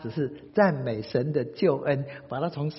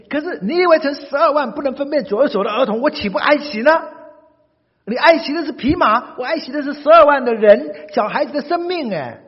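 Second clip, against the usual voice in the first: forceful rebuke; 10 semitones higher, 9 dB louder than usual. Acted. God rebukes Jonah.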